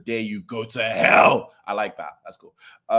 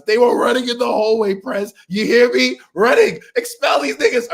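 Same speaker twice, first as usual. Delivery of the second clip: much higher, louder complaining shout; mock angry yell; 12 semitones higher, 7 dB louder than usual. Bakugo complaining to the class president, mock yell. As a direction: rant like an outraged hall monitor. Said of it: "And then Bakugo goes".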